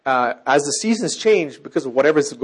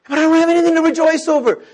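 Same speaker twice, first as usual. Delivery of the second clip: 1 complaining listener; 9 dB louder, faster than usual. Retold or imitated.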